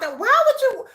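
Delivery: high-pitched appalled rant; indignant disbelief. Real, not acted. Viewer asking who thinks this suits children, indignant. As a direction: question it as if you cannot believe it. Outraged at talk in front of kids.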